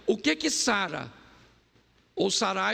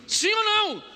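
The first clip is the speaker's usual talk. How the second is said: louder repeat; 12 semitones higher, 5 dB louder than usual. Acted. Repeated call, pushed higher.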